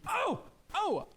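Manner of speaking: very high, surprised double "oh"; startled stranger. Acted. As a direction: act caught completely off guard. Second stranger acted out, startled likewise.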